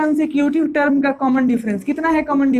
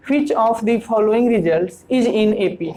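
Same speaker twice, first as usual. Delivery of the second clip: slow, careful read-out of an English question; reading the question aloud; 3 semitones lower, much slower than usual. Shown as a demonstration. Teacher reading the question to students, slowly. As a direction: read each word slowly for the class. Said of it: "ये कह रहा है".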